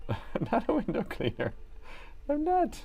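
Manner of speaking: lightly voiced sitcom character; IT Crowd quote again. Repeating the sitcom line to chat, lightly put-on voice. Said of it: "I T Crowd's the best".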